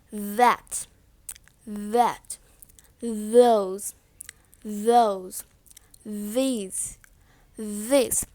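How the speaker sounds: "that" and "these" with a long buzzing TH; low, isolated model words, TH voiced in "this". Shown as a demonstration. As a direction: buzz the tongue to start "those". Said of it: "Voiced T H has vibration".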